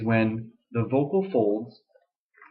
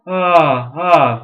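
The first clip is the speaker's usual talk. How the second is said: much louder, full-bodied ah; full modal ah, much louder; fuller voice; 5 semitones higher, 11 dB louder than usual. Shown as a demonstration. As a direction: open up into a full ah. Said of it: "we call that fuller voice".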